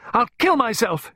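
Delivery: high, loud hysterical wail; uncontrollable cry. A narrator's reading. Pippa threatening herself before Clarissa, hysterical. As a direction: cry out completely out of control. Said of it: "she cried".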